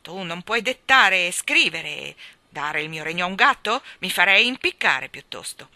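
loud haughty king, full of contempt; haughty laughing king. Acted. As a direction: scoff at the cat from the throne. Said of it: "rise altezzosamente".

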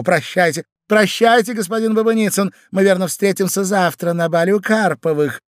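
high, singsong farewell; gushing society lady. Acted. Lady takes leave of her gentleman, gushing. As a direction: call goodbye gaily, pitched high.

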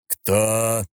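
rumbling machine voice; mechanical golem voice. A narrator's reading. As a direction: boom it like a stone golem. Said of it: "раскатистый механический голос Абаддона".

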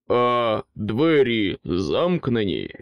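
slow, weighty royal question; king's heavy question. Acted. King asks ambition about the doors, slow and heavy. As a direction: ask it slowly like a suspicious king.

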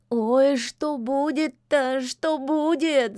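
slow, high, wailing cat; wailing lament. Acted. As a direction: moan it out in despair. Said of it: "запричитал кот".

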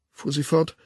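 ordinary delivery, nothing marked

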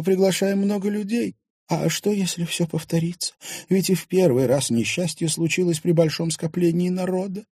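a lightly voiced nervous wife; anxious wife.

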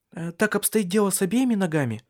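high, hurried woman's question; hurried, crumpled words. A narrator's reading. Woman asking about both feet, hurried and high. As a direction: rush it out, words running together. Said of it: "торопливо, комкая слова".

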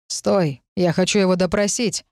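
ordinary delivery

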